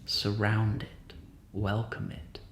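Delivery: steady, even meditation voice with long pauses; guided meditation. Real, not acted.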